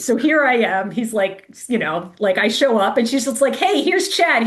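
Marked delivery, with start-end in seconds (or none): 3.5-4.5 higher-pitched retold greeting; mock chirpy introduction. Pacer introduces a stranger to the runner, lightly chirpy. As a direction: lightly imitate an upbeat introduction.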